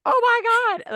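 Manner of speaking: high loud exasperated exclaim; mock despair at the lawnmower; mock exasperated oh my God. Retelling lawn noise, high exasperated cry.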